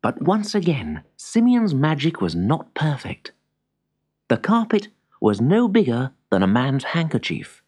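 usual speaking voice

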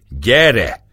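loud, high challenge; angry yell. A narrator's reading. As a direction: call him out angrily. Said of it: "rikoltotta dühösen Mekcsei".

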